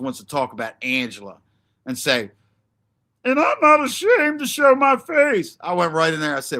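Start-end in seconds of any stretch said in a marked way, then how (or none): 3.2-5.6 mock quote. Mocking what Angela said.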